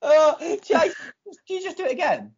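breathless after laughter; after laughing hard. Real, not acted. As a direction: still wheezing from laughing. After the mic gag.

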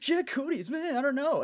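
a kid brushing off teasing, hammed-up; imitating a flustered boy denying he likes girls; defensive boy. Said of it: "he's all like".

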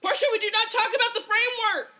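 high, loud mock-outraged shout; loud outburst. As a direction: yell it in fake outrage.

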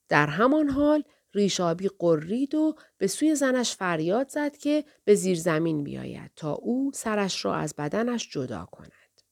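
plain reading voice

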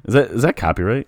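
usual speaking voice

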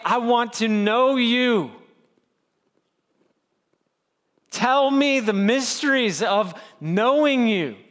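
slow, pleading prayer to God; earnest prayer. Acted. Pastor voicing the congregation's prayer, slow and earnest. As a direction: ask God slowly from the heart.

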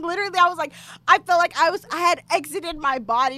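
usual speaking voice

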